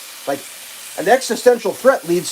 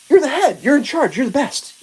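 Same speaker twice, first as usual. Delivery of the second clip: mock flattery; 6 semitones higher than usual.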